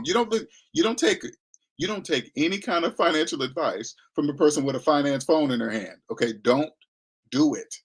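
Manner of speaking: ordinary delivery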